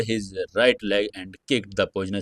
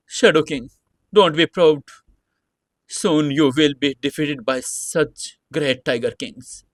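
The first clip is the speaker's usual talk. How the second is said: defiant threat; 5 semitones higher than usual. Acted. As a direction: spit the threat back. Defiant spider threatens Shadow King.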